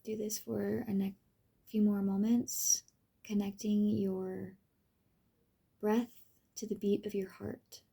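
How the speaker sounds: soft, unhurried meditation voice with long pauses; guided meditation. Acted. Reading a pathworking exercise to the listener, guided meditation. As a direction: gently lead the listener through the enchanted forest.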